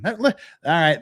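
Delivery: ordinary talk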